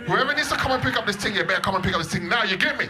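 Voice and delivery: loud, fast street-talk threat. Retold or imitated. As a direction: menace the customer service. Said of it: "This is what I wanna fucking say".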